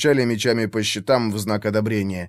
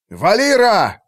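high, loud call; shouted name; 8 semitones higher, 5 dB louder, slower than usual. A narrator's reading. Commander calls the elf to shoot, shouted. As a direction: summon the archer with a shout. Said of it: "крикнул Артур".

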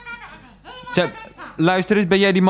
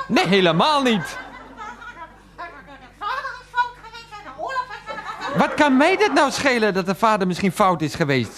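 raised-pitch exasperated protest; indignant; 6 semitones higher than usual. Acted. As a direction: argue back at your mother, pitched up. Quarrels with mother defending Nancy.

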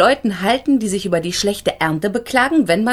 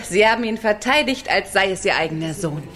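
usual speaking voice

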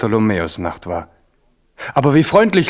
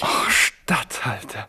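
mocking whisper; 2 semitones lower, 6 dB quieter, much slower than usual. Navarra mocks the offered title.

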